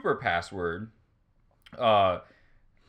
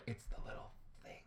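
hushed clue whisper; leaning in to whisper; 22 dB quieter than usual. Retold or imitated. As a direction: whisper it like a secret hint.

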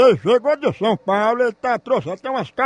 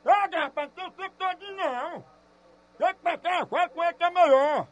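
half-laughing call across the room, away from the mic; calling off-phone; 4 semitones higher, 7 dB quieter than usual. Real, not acted. Caller urges Marcos from afar, half laughing.

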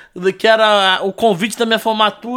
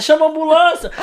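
higher-pitched bystander's cry; mock-urgent call for help; retold urgent call; 8 semitones higher than usual. Bystander in the crowd calling for an ambulance, mildly urgent cry.

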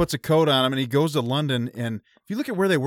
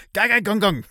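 nonsense German imitation, pitched much higher; mock fake German; 8 semitones higher than usual. Mocking an actor who can't speak German, high fake German gibberish. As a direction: mimic an actor badly faking German. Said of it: "nobody knew how to speak German".

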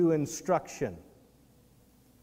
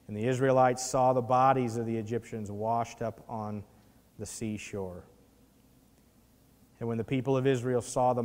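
softer, lower reverent reading of the verse; scripture reading; 5 semitones lower, 4 dB quieter than usual. Acted. Preacher reading the verse to the congregation, hushed and reverent. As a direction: let the passage land with hushed awe.

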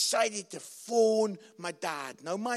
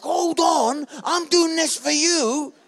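loud, high protest in a family row; retold shouting; 8 semitones higher, 10 dB louder than usual. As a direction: imitate yourself shouting back at your daughter. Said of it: "we're shouting at each other".

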